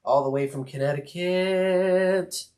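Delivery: drawn-out greeting. Acted. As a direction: stretch out every word.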